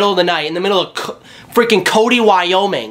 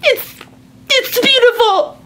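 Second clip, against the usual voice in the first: much higher, slow, shouted rapture; high-pitched shouted awe; delighted shriek. Retold or imitated. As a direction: squeal it like she's in love with the room. Mom overcome.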